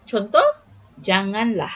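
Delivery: ordinary talk, nothing marked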